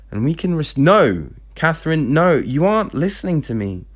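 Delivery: acted frustrated interruption; heated protest. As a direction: push back as if not being heard. Father and daughter argue in story.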